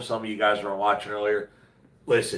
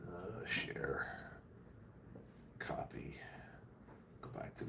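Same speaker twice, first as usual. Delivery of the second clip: whispered to himself; whispering; 4 semitones lower, 22 dB quieter, slower than usual. Real, not acted. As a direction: mutter the steps under breath. Muttering steps while posting link.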